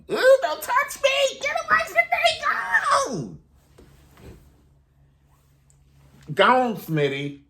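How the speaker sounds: hammed-up high scream to a creep not to touch her; disgusted shriek. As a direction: recoil and screech at him to get away. [name] shrieking at creepy Smitty.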